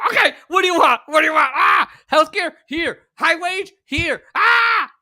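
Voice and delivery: shouted, high, panicky giving in; panicked officials. Pretend. Mock power bosses caving to strikers, shouting in high panic. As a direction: yell it like bosses caving to every demand.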